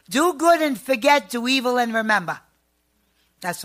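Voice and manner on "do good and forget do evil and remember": loud, high declaration of a saying; loud, proud family saying; proclaiming. Real, not acted.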